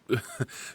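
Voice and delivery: a breathy little laugh at himself; soft embarrassed laugh. Acted. Caught rambling to his new acquaintance, a soft sheepish laugh.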